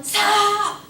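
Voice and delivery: loud, high shouted command; sudden yell. Shown as a demonstration. Teacher demonstrating the surprise braking test, high sudden yell. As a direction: shout to make a driver hit the brakes. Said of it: "So if I yell".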